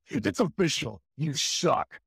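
taunting villain boss voice; big antagonistic trash-talk proclamation; Shao Kahn. Acted. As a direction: declare it like an overpowered game villain. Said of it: "I put that voice in Shao Kahn".

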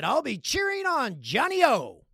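high, slow, loud mom voice; imitating mom. Mom announces her curling favorite, imitated high cheer. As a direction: cheer proudly in a hammed-up mom voice. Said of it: "My mom said".

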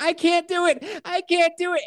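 imitating a woman in labor, very high-pitched; high retold wail of giving up; panicked labor cry.

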